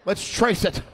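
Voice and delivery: loud mock cop excitement; mock investigators. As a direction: play eager detectives. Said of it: "They're like".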